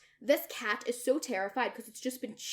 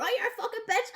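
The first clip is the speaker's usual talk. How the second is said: mock insult; 11 semitones higher, much faster than usual. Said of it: "it's a joke".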